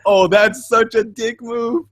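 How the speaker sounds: slow, drawn-out disapproval; reacting to a friend's betrayal, slow and indignant; mock indignation. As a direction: stretch it out, scandalised.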